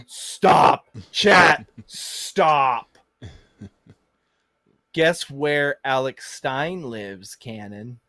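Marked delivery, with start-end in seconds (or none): 0.1-3.0 shouted stop, chat; loud stop. Real, not acted. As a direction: yell it in shock. Shocked reaction to clip.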